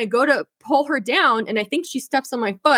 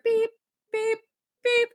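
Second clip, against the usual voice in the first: imitated truck beeping; 11 semitones higher, 4 dB quieter than usual. Mimicking the delivery truck's beeping.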